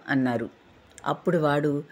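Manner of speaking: ordinary delivery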